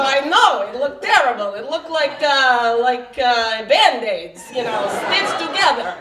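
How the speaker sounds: loud, high Russian-New York saleslady; rough Russian-New York accent. Acted. Bra fitter condemning an old bra, rough Russian-New York accent. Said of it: "I don't do a very good Russian accent".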